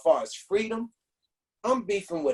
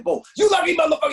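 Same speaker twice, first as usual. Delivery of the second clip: much higher yelled insult; bad cop shout; 9 semitones higher, 6 dB louder, faster than usual. Cop yelling at the freed rapper, mock shout. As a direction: yell it at him, hammed-up tough cop. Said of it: "back into his bad stuff".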